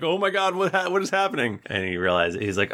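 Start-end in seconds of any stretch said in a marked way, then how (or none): 0.0-1.6 mock panic. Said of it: "Harry's like".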